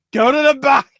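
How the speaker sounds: high, loud mock command; shouted imitation of a referee; ref's order. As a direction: send them off like an angry ref. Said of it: "the ref's just like".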